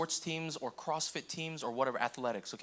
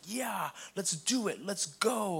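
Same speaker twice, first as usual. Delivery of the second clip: pumped; 3 semitones higher, much slower than usual. Pretend. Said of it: "some of you in here are pumped".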